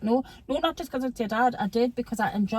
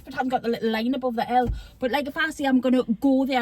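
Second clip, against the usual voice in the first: normal talking voice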